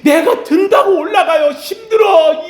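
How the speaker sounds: loud, high strained outcry; urgent, struggling. Acted. Old Moses cannot raise his tired arms, acted urgent outcry. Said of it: "모세가 마음이 다급한 거예요".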